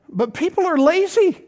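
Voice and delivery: high, loud preacher's push; preaching at full force. Real, not acted. Pastor pressing the church on hard work, preaching loud and high. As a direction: drive the point home to the congregation.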